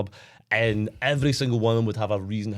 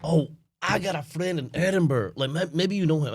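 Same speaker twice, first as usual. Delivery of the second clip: mock American accent; 3 semitones higher than usual. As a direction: play a naively friendly American. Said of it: "when I used to talk to these Americans".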